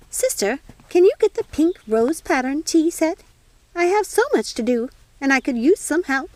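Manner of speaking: a busy fairy godmother fussing over tea; fussy fairy godmother. Acted.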